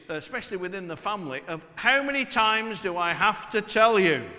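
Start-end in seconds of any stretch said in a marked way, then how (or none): 1.8-4.3 loud, exasperated "How many times do I have to tell you?"; exasperated parent. Retold or imitated. Parent scolding family, loud and exasperated. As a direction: raise it loud like a fed-up parent. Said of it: "that immortal phrase".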